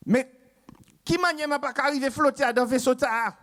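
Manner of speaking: put-on voix aiguë de Martiniquais affolé, accent créole; accent antillais paniqué. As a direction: jouer le Martiniquais qui panique en flottant. (accent antillais affolé, voix aiguë)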